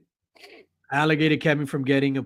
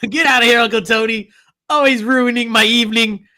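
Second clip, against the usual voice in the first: high, loud outburst of fake annoyance; mock scolding; 7 semitones higher, 5 dB louder than usual. Chasing Uncle Tony off, loud mock scolding. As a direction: yell it like an exasperated relative shooing someone away.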